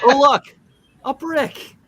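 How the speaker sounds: comic alarm at the flying brick; mock alarm. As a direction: point out the brick in fake alarm. Brick thrown in scene.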